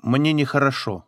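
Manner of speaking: usual speaking voice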